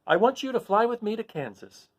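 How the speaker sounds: put-on high girlish plea; a hopeful child asking; Dorothy. Dorothy asking the Monkey King to fly her home. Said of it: "said Dorothy".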